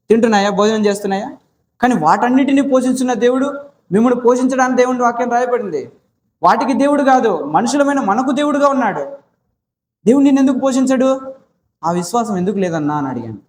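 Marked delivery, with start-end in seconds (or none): 1.8-11.3 rising preaching. Acted. Raised preaching about God providing.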